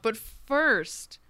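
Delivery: ordinary talk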